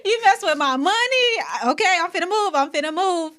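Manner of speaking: high, loud, hammed-up giving in; playful alarmed yelp of compliance; mock panicked surrender. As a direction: cave in comically, voice shooting up. Comically acts out giving in to God.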